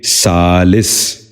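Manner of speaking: سالس ending "-lis", short i; short i before the final s of سالس; correct pronunciation. Shown as a demonstration. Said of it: "ذہن نشین کر لیجئے".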